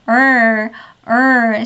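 loud curled 얼; R sound demo. Shown as a demonstration. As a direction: curl the tongue back on 얼. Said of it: "러 이런 소리가 나는데".

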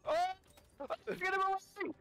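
ordinary talk

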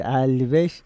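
low Samsun regional Turkish; Samsun accent. Shown as a demonstration. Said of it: "Samson şivesiyle".

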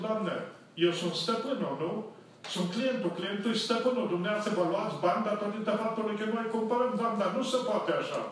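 loud, high lecturing tirade; indignant lecture. Retold or imitated. Pastor lecturing the cashier that the client is master, indignant and loud.

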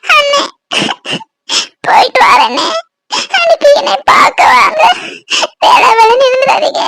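crying, voice leaping high and dropping; tearful farewell. Acted. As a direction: sob through the goodbyes. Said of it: "அழாத பூமாரி".

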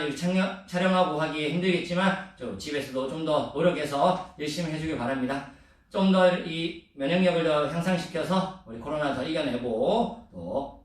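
ordinary talk

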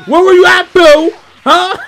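high, loud mock interrogation; hammed-up jealous lover grilling Buu; playfully grilling Buu like a cheating partner.

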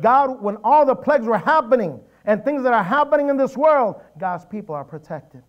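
high, loud proclamation; forceful preaching. Real, not acted. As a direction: declare it with full pulpit force.

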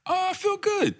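high, bright oh I feel good; high delighted. Real, not acted. Delighted answer about the rollout.

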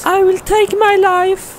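very high, loud falsetto; frightened girlfriend. Acted. Girlfriend fending off the monster, high upset cry.

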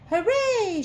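high, loud drawn-out cheer; cheering shout. A narrator's reading. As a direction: shout it out like a happy crowd. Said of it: "shouted the people".

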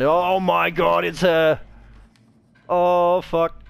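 panicked. Real, not acted. Monster appears, panic.

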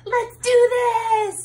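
rally the band with it; cow, pumped up. Acted. Cow psyching the band up before the show, hammed-up cheer.

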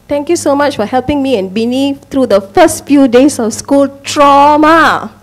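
proud parent reading out the word trauma; reading child's note. Retold or imitated. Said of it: "my daughter is trying to emphasize both the feeling".

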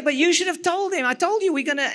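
mild mock telling-off. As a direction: protest with the pitch pushed up. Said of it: "raised my voice a little bit".

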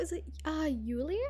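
ordinary talk

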